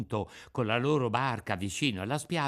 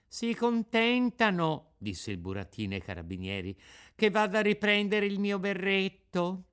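polite puppet; 7 semitones higher than usual. A narrator's reading. Pinocchio asks the carabinieri to fetch his cap, sweet. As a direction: ask with feigned innocence.

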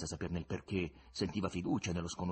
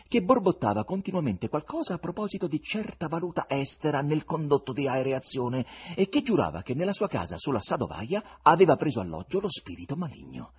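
usual speaking voice

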